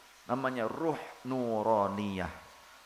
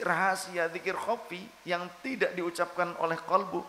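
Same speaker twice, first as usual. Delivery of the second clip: preaching at full force; 6 semitones higher than usual. Acted. Preacher urging listeners on silent zikir, preaching at full force. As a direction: drive the point home with fire.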